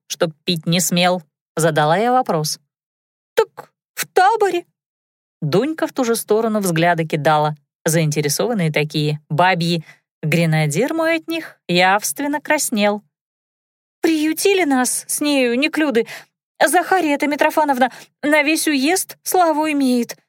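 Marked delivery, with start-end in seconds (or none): 3.3-4.6 high, fast squeaky maid; squeaky girl voice. A narrator's reading. Dunka squeaking. 14.0-20.2 high, fast squeaky maid; squeaky girl voice. A narrator's reading. Dunka squeaking.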